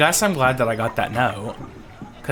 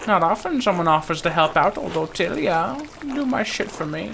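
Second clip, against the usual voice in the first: lightly voiced old villager, raised pitch; hint of old Ottilia; 6 semitones higher, 3 dB louder than usual. Old Ottilia to someone offering help, wry.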